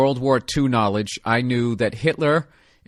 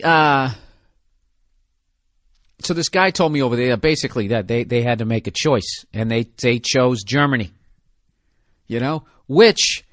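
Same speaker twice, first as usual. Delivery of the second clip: usual speaking voice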